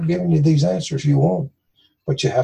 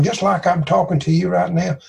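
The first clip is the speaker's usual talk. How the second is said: ordinary talk, nothing marked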